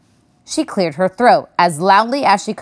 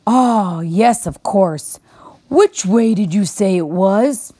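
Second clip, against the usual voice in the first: put-on slow old bear; slower than usual. A narrator's reading. Bear's sleepy reply.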